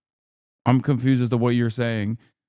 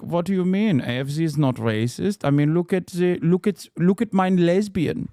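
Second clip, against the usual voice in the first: mock-defensive German party supporter, pitched up; German accent; 4 semitones higher than usual. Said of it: "so that you can be like".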